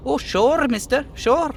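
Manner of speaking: little dockhand eager to please; eager little guy. Acted. Voicing little guy NPC.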